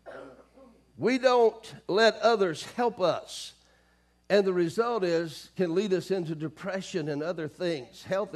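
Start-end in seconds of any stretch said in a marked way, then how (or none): 1.0-6.3 loud, slow, higher preaching voice; forceful preaching. Real, not acted. Preacher warning those who refuse help, forceful preaching. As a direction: land the point slowly and loudly.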